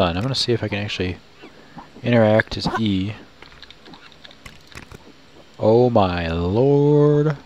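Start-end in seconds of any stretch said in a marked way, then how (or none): 5.6-7.4 very slow, stretched exclamation; drawn-out amazement. Real, not acted. Player reacting to what appears on screen, awed drawn-out exclamation. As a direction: draw each word out in awe.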